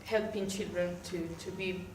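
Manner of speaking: ordinary talk, nothing marked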